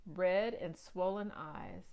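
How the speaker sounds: ordinary delivery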